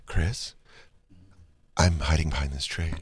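soft, low, hesitant voice; sad, timid fan. Retold or imitated. Sad fan calling to Chris from hiding, timid. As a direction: imitate a shy fan hiding away. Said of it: "I was very sad".

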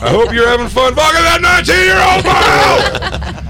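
high, loud, slow mock yell; mock Hogan yell. Hogan yells at his ex-wife from the boat, high and slow. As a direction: scream it slowly across the water. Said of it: "He's like".

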